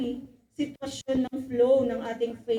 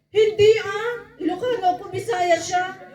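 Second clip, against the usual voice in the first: high, loud denial through a laugh; hammed-up Peter chuckling his way out of it; laughing nervous denial; 6 semitones higher, 8 dB louder than usual. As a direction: laugh it off as if caught lying.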